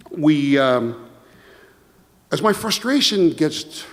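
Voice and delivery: usual speaking voice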